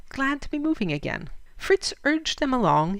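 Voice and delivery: plain reading voice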